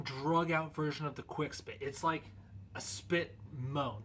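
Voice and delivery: ordinary talk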